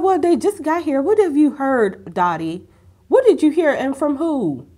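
high, loud, incredulous demand; loud, high-pitched disbelief at a nosy neighbor; indignant questioning. Retold or imitated. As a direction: challenge her like you can't believe it. Retelling indignant reaction to Dottie.

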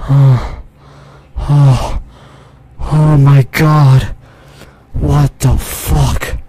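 heavy gasped exclamations, then slow shock; real, stunned and out of breath; shaken by fright. Real, not acted. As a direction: pant it out after a big scare. Reeling after the jump scare.

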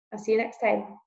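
ordinary delivery

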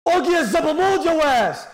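high screaming taunt; raging gamer. Real, not acted. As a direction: scream it at the beaten rival. Rival killed, streamer raging.

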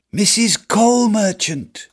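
high, slow called-out greeting; the beggar shouting to the merchant's wife; shouted plea. Acted. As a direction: call up to her, pleading. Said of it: "I shout".

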